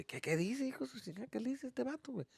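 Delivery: hushed, high puzzled Spanish aside; imitating baffled dad. Dad baffled by the friend's slang, hushed Spanish impression. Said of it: "what my dad would say".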